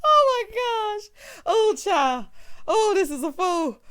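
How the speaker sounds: loud, high wail through laughter; real, squealing amused disbelief; laughing hard. Real, not acted. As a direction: gasp it out while cracking up. Recovering from laughing fit.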